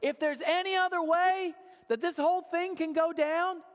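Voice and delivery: high-pitched acted plea to a father; imploring, pitched well up; calling out to Father. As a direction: call out in prayer, voice lifted high. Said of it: "heard him call out to his Father".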